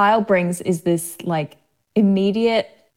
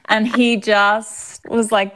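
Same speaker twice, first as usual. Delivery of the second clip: ordinary delivery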